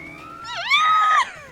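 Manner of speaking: piercing high mascot laugh; high shrieking cackle. Acted. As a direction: let out a shrill squeal of a laugh. Character shriek.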